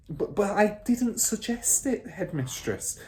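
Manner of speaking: put-on high, stammering young teacher; teacher flustered by the accusation. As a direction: splutter in protest. Miss Honey voiced.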